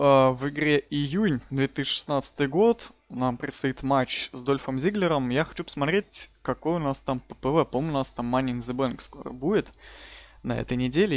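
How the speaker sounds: ordinary delivery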